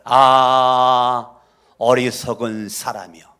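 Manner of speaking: loud drawn-out 아 of grief, then the words; lamenting cry. Acted. James lamenting over his foolish hearers, loud grieving cry. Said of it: "탄식하는 거죠".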